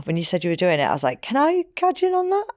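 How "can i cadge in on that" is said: high-pitched retold plea; pitched way up, imitating an eager ask; eager request. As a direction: ask to join in a squeaky little voice. Retells own eager request to join.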